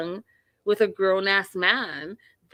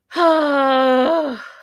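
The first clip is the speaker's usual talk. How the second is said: long, loud, high groaning sigh of disgust; exasperated loud sigh; 7 semitones higher, 7 dB louder than usual. Real, not acted. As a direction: let out a heavy, fed-up sigh. Disgusted by Ed's behaviour.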